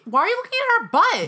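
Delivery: loud, very high-pitched accusation; indignant. Real, not acted. Fiancee confronting partner over looking at another woman, indignant. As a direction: demand it, voice shooting way up.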